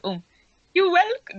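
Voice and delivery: high sweet butler voice; wrong Bulbage voice. Acted. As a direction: try a high, polite servant. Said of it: "No, hang on, what was Bulbage's voice?".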